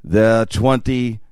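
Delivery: slow, weighty delivery; dramatic narrator. Retold or imitated. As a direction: savor each word like an epic film voice-over. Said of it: "when he goes".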